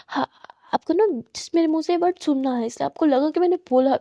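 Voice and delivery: plain reading voice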